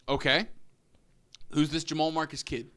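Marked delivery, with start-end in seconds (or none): none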